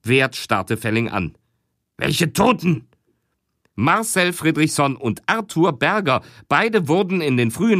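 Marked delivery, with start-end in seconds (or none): 2.0-2.9 higher, surprised question; puzzled boss. Acted. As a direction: ask up high in surprise.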